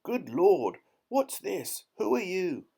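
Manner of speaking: high, staggered exclamations; startled, incredulous outburst; astonished gasping. A narrator's reading. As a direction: splutter questions in high-pitched shock. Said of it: "he gasped".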